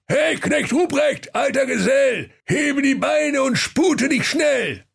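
very high, slow, drawn-out call. Acted. Santa calling his helper from bed, high slow call. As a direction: call across the house, stretched high.

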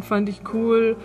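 normal talking voice

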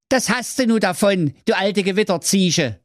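high-pitched, amused jeer; gloating Schwerthelm. A narrator's reading. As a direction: crow over the witch in a high hero voice. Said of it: "Der Schwerthelm lachte". (schadenfroher Held, hohe Stimme)

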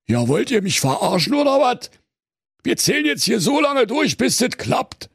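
high, mild mock rage; hammed-up ranting bear; raging bear. A narrator's reading. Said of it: "tobte der Bär".

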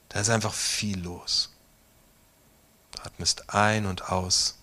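guided meditation. Acted. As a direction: guide them gently into the storm scene. Leitet eine Meditation. (ruhige Meditationsstimme, langsam mit langen Pausen)

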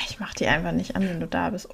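hushed whispered aside; whispering. Real, not acted. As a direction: drop to a whisper. Whispered aside about candles.

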